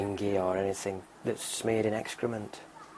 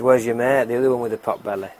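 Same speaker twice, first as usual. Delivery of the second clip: loud, slightly raised demand; louder, quick-fire police question; 2 semitones higher, 10 dB louder than usual. Acted. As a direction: question the suspect loudly. Police question robber in joke.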